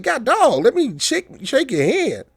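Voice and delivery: high, incredulous exclamation; hammed-up high-pitched disbelief; incredulous retort. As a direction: play it up like a man shocked at a quick offer. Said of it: "I'm like".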